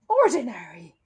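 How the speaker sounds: loud offended exclamation; outraged at the word; a protective housekeeper bristling; indignant. Acted. Mrs Bird indignant at remark.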